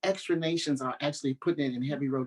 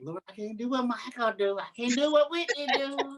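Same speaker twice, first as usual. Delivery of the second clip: higher, half-comic prayerful whine; mock plea to the Lord; 8 semitones higher than usual. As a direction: play the desperate young singer begging God. Pleading to God in retelling.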